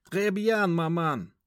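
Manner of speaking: put-on high, mincing young man; mock French politeness. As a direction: answer in affected French. Son answers mother in French.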